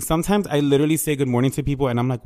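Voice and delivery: ordinary talk, nothing marked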